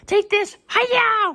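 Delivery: shrill little karate shout in the new real voice; squeaky real voice. Acted. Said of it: "here's my real voice".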